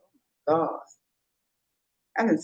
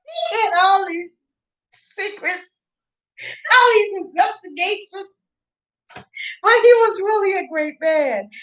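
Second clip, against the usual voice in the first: laughing; 10 semitones higher than usual. Real, not acted. Still laughing about Eisenhower.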